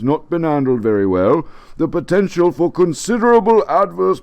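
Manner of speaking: pompous British official reading; official letter voice. Retold or imitated. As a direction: read it like a stuffy bureaucrat.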